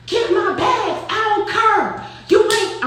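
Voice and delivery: imitated high Saint Louis accent. Noonie arguing with Flo by the stairs, Saint Louis accent. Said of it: "I'm gonna do it in her accent".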